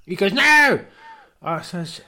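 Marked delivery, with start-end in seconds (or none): 0.3-0.8 high loud yelp; mock outraged no. Elton yelping no at lockdown rules.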